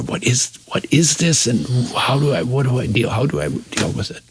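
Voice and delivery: half-voiced retold thoughts, searching; quiet inner questioning. Young student asking himself how to deal with it, quietly puzzled.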